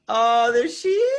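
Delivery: high, drawn-out delighted coo; cooing greeting. Real, not acted. Greeting the baby on camera.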